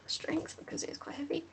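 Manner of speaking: hushed game-master aside; whispered note about the strength roll. Real, not acted. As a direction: whisper the roll call to the table.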